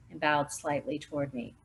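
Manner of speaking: ordinary delivery, nothing marked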